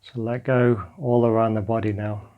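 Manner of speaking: guided meditation. Acted. Teacher guiding listeners lying on the floor through a body scan, calm meditation voice. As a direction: guide them gently into letting go.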